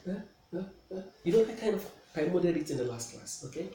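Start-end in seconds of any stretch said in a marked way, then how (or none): 0.2-1.0 hushed, hesitant little "eh"s; soft innocent stammering of a caught man; meek caught kidnapper. Acted. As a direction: act the subdued suspect under questioning. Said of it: "When they ask him questions, he feels like".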